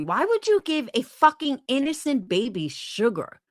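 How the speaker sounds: ordinary talk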